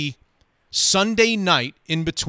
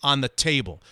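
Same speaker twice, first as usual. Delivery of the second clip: usual speaking voice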